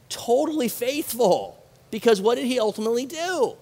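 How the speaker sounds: normal talking voice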